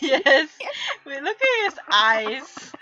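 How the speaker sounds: laughing. Real, not acted. Cracking up at fan art.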